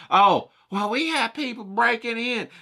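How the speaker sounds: high, put-on complaining voice; Karen voice. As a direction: lightly whine about break-ins. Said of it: "she goes".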